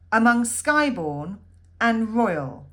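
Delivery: slow, loud reading with weight on "royal"; stressed poem reading. Shown as a demonstration. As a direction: lean into "royal" and give its syllables full length. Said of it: "that word royal is where we're adding on the extra syllable".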